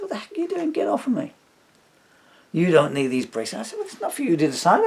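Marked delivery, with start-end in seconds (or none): 0.0-1.3 high, loud retold outburst; angry protest. Retold quarrel with attacker. 2.5-3.7 loud, high re-enacted demand; aggressive attacker. Acting out the attacker's words. 3.8-4.8 loud, high, rushed comeback; indignant retort. Retold or imitated. Retold quarrel with attacker.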